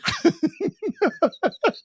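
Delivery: high, rapid staccato laugh; real high-pitched chuckling run. Real, not acted. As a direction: burst out laughing at your own joke. Laughing at his own joke.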